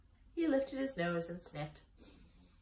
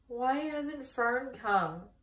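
slow, high, near tears; little pig near tears, wondering why Fern hasn't come; tearful piglet; 6 semitones higher, 3 dB louder, much slower than usual. A narrator's reading. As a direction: plead slowly for Fern, close to crying. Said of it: "He began to cry".

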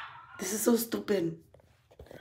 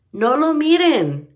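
sudden high, loud snap at the haters; angry outburst; 7 semitones higher, 5 dB louder than usual. Real, not acted. Vlogger shouting at her YouTube haters, angry. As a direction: hurl it at them, fed up.

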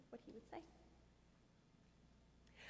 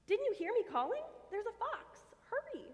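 much higher, urgent and breathless; urgent warning; 12 semitones higher than usual. Acted. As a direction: hurry her away from danger.

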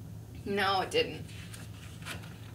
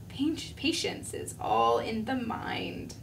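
slow whispered self-calming; a caterpillar soothing himself in a slow whisper; 5 dB quieter than usual. Acted. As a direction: breathe it out as a whisper.

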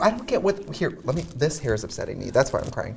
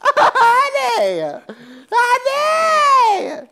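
high drawn-out shriek; screaming queen; shrieked honey; much slower than usual. Pretend. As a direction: wail it like a diva.

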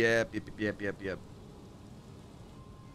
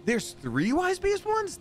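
incredulous; slower than usual. Real, not acted. Incredulous at the quest text.

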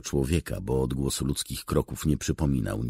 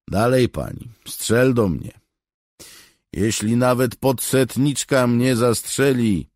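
high, loud defiance; calling out; 11 semitones higher, 8 dB louder than usual. A narrator's reading. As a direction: dare her at raised volume. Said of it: "znów zawołał do legionistów". (głośne, wyzywające zawołanie)

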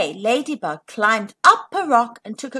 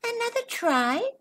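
soft, higher wondering question; quiet hopeful thought; thinking aloud; 11 semitones higher, 6 dB quieter than usual. Acted. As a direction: muse it to yourself. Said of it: "thought Ladybug".

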